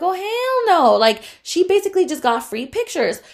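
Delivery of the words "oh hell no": outraged hell no. Retold or imitated. Outraged inner thought about client.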